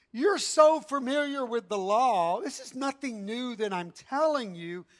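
usual speaking voice

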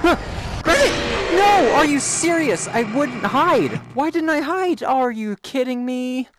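high, loud frantic yelling; panicked shouting. Real, not acted. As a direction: yell in real panic. Caught by the monster, panicking.

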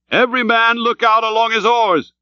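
high, sustained command; crying out. A narrator's reading. Starbuck orders his crew to look out, crying out. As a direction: call the order to every oarsman. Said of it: "cried Starbuck".

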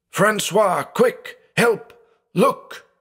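very high, urgent cries without much volume; panicked roar. A narrator's reading. Blind man rousing his sleeping brother, panicked roar. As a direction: call out frantically in short, high bursts. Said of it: "threw himself out of bed and roared".

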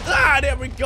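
loud relieved shout; sudden loud outburst. Real, not acted. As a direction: burst out loudly.

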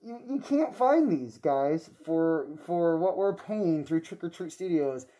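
muffled behind rubber mask; speaking through a face mask. Real, not acted. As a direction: voice covered by mask. Seen 0: Full mask over face and mouth throughout; off before and in refs.

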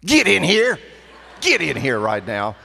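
loud, high holler to the dog; practical dog call. Retold or imitated. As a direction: shout the dog in, no nonsense. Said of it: "She says".

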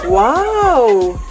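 loud, high, swooping wow; amazed. Real, not acted. Vlogger marvelling at the finished dish, amazed. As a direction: let the wow rise and fall. Sees the served food.

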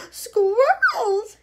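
high loud shriek of the word; a spoiled girl's piercing shout. Acted. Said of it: "shouted Veruca Salt".

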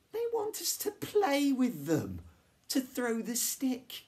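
very high, loud excited cry; excited realization. A narrator's reading. Girl telling friends the dogs want play, excited shout. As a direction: call it out high, slowing at the end.

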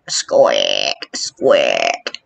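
deep creaky squick noise; imitated creaking. Vlogger imitating creepy creaking steps, deep mock squeak. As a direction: mimic the creaking steps down low. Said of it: "Creepy music. Can you hear it?".

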